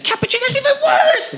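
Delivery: very high, laughing mock barista voice. Barista scoffing at his cappuccino order, high laughing. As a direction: squeal it like she can't believe it. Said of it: "And then she's like".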